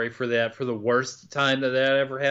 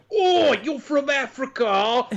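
high, hammed-up Cockney jeering; exaggerated Cockney taunt, drawn out at the start; heavy Cockney accent; 9 semitones higher than usual. London teens mocking a woman's African origins, heavy Cockney accent. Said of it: "they had pretty heavy Cockney accents".

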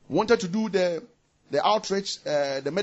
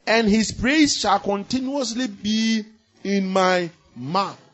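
slow, solemn recitation of the psalm; scripture reading; 4 semitones higher, 5 dB louder than usual. Acted. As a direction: read each word with weight.

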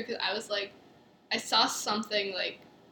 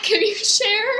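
higher, louder pleading question; hammed-up plea for help; mock timid plea; 9 semitones higher, 9 dB louder, slower than usual. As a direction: beg politely like a lost newcomer. Acting asking strangers for help.

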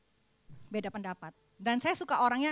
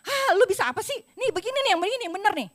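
high, loud mocking scolding; mock someone who looks down on you; imitating a condescending boss, loud and high; belittling scolder; 8 semitones higher, 7 dB louder, faster than usual. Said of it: "kalau seringan digini".

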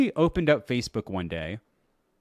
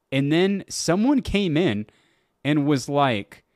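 normal talking voice

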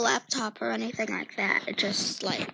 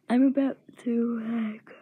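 whispering. Real, not acted. Whispering secret about room.

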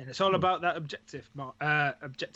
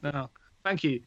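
ordinary talk, nothing marked

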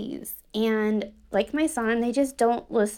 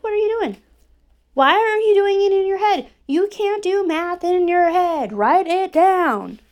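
higher, louder snap, slowing on the last words; snapping; 7 semitones higher, 5 dB louder than usual. Retold or imitated. Mom snapping at daughter doing math in her head, harsh. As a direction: bark the questions at her, then drag out write it down. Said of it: "I just snapped at her".

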